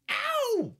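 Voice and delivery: very high, sharp mock yelp; sudden squealed imitation of pain. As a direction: yelp as if just shot, hammed-up. Acting the shot that ends film.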